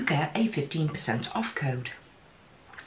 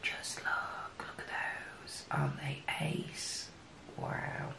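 awed whisper; 15 dB quieter than usual. Real, not acted. Admiring new eyeshadow swatches.

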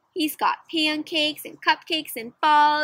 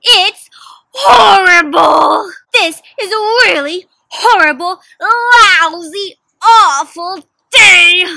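slow, crying, much louder shouted wail; furious shout; 5 semitones higher, 11 dB louder, much slower than usual. Acted. Kevin melts down over his awful day, shouting slowly. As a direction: howl out the last straw. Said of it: "Kevin says".

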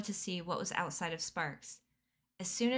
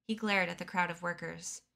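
plain reading voice

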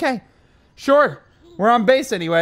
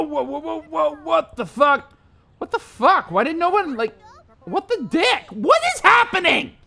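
normal talking voice

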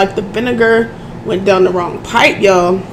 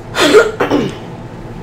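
throat clearing; 3 semitones higher, 3 dB quieter than usual. Real, not acted. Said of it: "I'm sorry I keep doing that".

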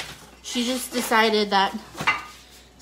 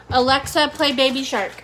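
raised, higher voice for a voice assistant; loud command; 3 semitones higher, 6 dB louder than usual. Real, not acted. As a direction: call out to the smart speaker. Calls to the smart speaker.

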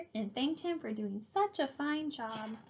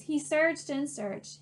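ordinary delivery, nothing marked

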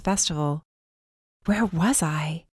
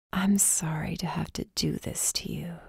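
eerie whisper; 8 dB quieter than usual. A narrator's reading. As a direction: whisper it like a hooded threat. Cloaked captor speaks to prisoner.

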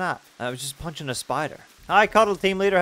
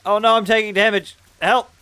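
panicked cry for help; 8 semitones higher, 11 dB louder than usual. Real, not acted. Taking damage from cold.

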